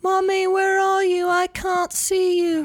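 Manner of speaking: put-on high, slow old-movie little girl; transatlantic little girl. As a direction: whimper for mommy in a high child voice. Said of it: "I like the little girl has a transatlantic accent".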